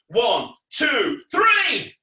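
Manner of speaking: showman's rousing countdown; shouted count-in. Pretend. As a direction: build to a high, loud "three".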